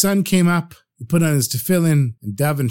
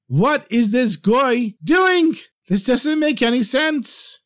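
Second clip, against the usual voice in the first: baffled; 7 semitones higher, slower than usual. Acted. Rabbi baffled watching collapse.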